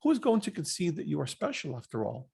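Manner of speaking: usual speaking voice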